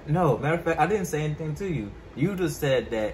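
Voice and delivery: insistent protest. Real, not acted. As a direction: push back loudly to set the record straight. Arguing back over who started it.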